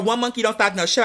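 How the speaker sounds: ordinary delivery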